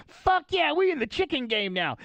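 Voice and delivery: high, loud gleeful shout; little Chappelle. Retold or imitated. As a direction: celebrate like a tiny gleeful imp. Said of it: "the little Chappelle goes".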